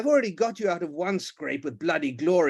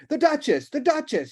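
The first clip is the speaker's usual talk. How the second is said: loud, much higher call; shouting; 12 semitones higher, 5 dB louder than usual. Acted. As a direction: shout down the hall. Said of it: "he shouted".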